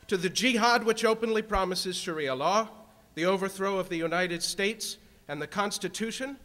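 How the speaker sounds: building to a full-throated roar; roaring rallying speech. Real, not acted.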